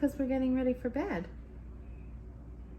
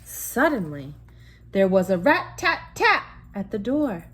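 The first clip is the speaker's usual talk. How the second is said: story narration; 6 dB louder than usual. A narrator's reading. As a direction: tell it like a bedtime story to a child. Bedtime story narration continues.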